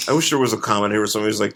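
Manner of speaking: ordinary delivery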